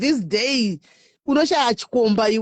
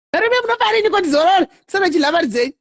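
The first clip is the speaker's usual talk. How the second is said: excited; 9 semitones higher, 5 dB louder than usual. Real, not acted. Laughing excited outburst.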